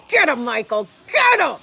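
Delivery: loud, high retold yell; dad yelling. Imitating the dad yelling at Michael to get him, loud. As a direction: bellow it like a dad cheering on his son. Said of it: "their dad is yelling".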